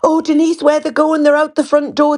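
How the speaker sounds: high, fretting imitation of mum; worried mum. Grandmother fretting to her daughter about the kids, high mock worry. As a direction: pitch it up like an anxious grandmother. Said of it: "my mum wouldn't let them out the lounge".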